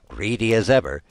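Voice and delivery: ordinary delivery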